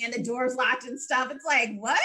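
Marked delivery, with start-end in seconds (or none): 1.8-2.1 high incredulous laugh after the word; laughing disbelief. Retold or imitated. Podcaster baffled by the scene, laughing in disbelief. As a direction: ask it, then crack up laughing.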